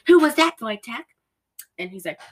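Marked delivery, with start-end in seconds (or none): 0.1-1.0 a hint of a high-pitched questioning woman; higher, mildly put-on query; Sharon Tate. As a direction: lightly imitate her higher-voiced question. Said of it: "Sharon Tate's like".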